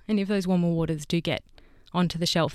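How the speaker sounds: ordinary delivery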